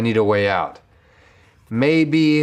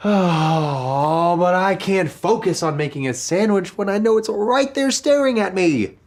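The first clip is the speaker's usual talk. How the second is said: whiny; 10 semitones higher, 3 dB louder than usual. Acted. Whining over the tempting sandwich.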